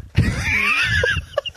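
shrieking laugh. Real, not acted. Laughing at own story.

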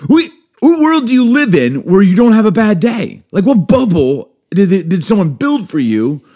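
incredulous rant. Real, not acted. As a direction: scoff at the idea of no bad days.

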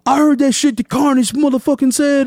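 high, projected mock call-out; retold stage call-out. Rapper addresses the crowd after his first song, calling out. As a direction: imitate a rapper hollering from the stage.

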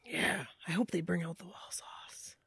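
soft whispered aside; whispering. Real, not acted. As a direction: whisper it like a secret. Whispered aside about Wahlburgers sauce.

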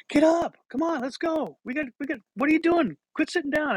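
high-pitched mock yell; yelling coach. Coach yelling at the fallen player, high mock yell. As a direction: imitate an impatient coach calling across the field. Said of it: "the coach is yelling".

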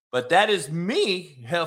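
normal talking voice

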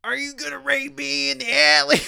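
high-pitched impression of an accusing old street woman; imitating a street lady. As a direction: squeak it up high like the haggard alley lady. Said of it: "she starts yelling".

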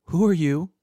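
high, loud frightened demand; alarmed challenge. A narrator's reading. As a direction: call out to an unseen intruder.

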